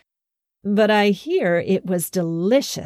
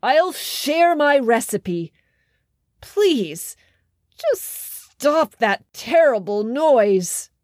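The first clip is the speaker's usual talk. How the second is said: slow, higher, beaten king; giving-in king; 5 semitones higher, slower than usual. A narrator's reading. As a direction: surrender to make the noise stop. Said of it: "the king shouted at last".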